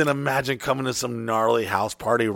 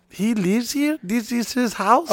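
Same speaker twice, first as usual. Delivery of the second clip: amazed neighbors; 8 semitones higher, 4 dB quieter, slower than usual. Retold or imitated. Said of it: "they're just like".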